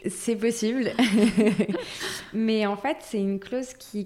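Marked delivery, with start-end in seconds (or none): 1.0-2.3 brief amused chuckle; a quick light laugh at the question; short laugh. Real, not acted. Amused reply in chat.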